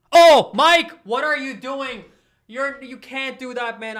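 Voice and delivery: high, loud, drawn-out disbelief; a fan yelling in disbelief at his own captain; stunned outcry. Real, not acted. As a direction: cry out at the keeper's blunder in shock. Keeper blunder, stunned outcry.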